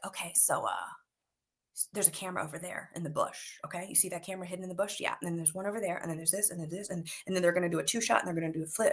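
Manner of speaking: low, soft rundown; confidential technical aside. Retold or imitated. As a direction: imitate a veteran quietly pointing things out. Imitates co-star's technical muttering.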